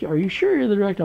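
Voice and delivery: quick, high-pitched doubtful question; incredulous questioning. Retold or imitated. Elderly man questioning the young director, incredulous.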